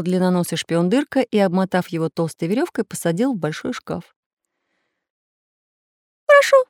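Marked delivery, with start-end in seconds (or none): 6.3-6.6 small sad voice of surrender; sadly agreeing. A narrator's reading. Little artist gives in to the pirates, sadly. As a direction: give in sadly. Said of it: "грустно согласился волшебный художник".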